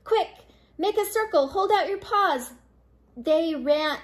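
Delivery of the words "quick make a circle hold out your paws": high, hurried command; rally helpers, pitched up; urgent orders. A narrator's reading. Pip orders an urgent rescue.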